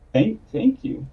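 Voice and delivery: high, halting thanks with a rise; stuttering, confused. Acted. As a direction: stumble over it, caught off guard. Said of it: "stutteringly, like, confused".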